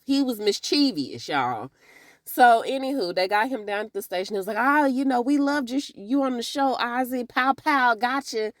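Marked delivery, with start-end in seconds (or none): 4.6-8.5 somewhat higher chummy gushing; butter him up like a fan; fan cop voice. Retold or imitated. Detective flattering Ozzy with his old catchphrase, a bit higher. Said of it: "I guess that was the slogan".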